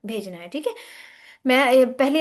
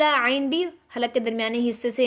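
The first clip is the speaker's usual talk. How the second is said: normal talking voice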